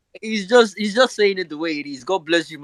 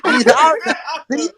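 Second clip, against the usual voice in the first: laughing mimicry; 10 semitones higher, 4 dB louder than usual. Laughing imitation of friend's cursing.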